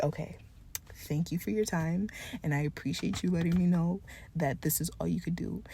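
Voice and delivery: softly retold polite thanks; subdued polite reply. Driver thanking the helper who had to leave, subdued and polite. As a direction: quote yourself softly, politely accepting it.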